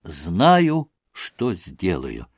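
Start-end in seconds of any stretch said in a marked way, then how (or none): none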